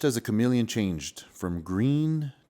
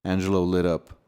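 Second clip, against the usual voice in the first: ordinary delivery, nothing marked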